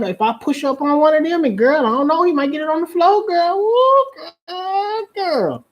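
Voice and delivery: high, whooping mock girlfriend; imitated excited girlfriend. Girl hyping friends about cruise men, high excited mock voice. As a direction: build to a squealed whoop. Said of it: "Ain't that what y'all say?".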